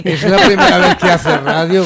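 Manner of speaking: laughing tease. Real, not acted. Host laughing at a nervous co-host on his first radio day. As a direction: tease him through a laugh.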